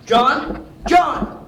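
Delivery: high, loud calls, rising in urgency; searching shout. Acted. Detective searching for missing John, shouting his name. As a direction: yell the name to find him.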